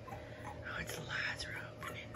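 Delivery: fast soft whisper. Real, not acted. Noticing eyes opening, quick whisper. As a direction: murmur it under your breath. Whispering over newborn puppies.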